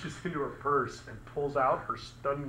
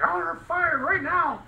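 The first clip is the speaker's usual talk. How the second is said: much higher, whiny mock demand; mad guy whining; 13 semitones higher than usual. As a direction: mimic a sulky guy demanding she's fired. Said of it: "He was so mad".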